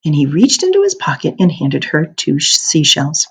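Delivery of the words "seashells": plain reading voice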